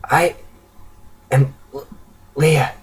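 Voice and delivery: soft, weak, broken speech; exhausted, halting young refugee; faltering from exhaustion. A narrator's reading. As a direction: push each word out with long pauses, struggling for strength. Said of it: "She falters from exhaustion".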